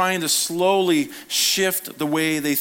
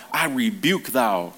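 mock old-fashioned rebuke; 3 semitones lower, slower than usual. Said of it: "We see this rebuke like".

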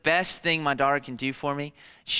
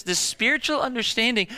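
ordinary delivery

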